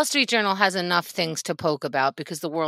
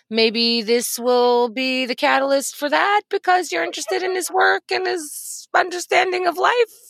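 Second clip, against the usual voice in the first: mock-innocent pitch; 7 semitones higher than usual. Posing as the paper excusing itself, mock-innocent. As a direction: float it upward like a tentative suggestion.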